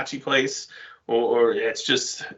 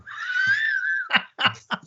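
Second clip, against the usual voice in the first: burst of laughter. Real, not acted. Joke about the accent.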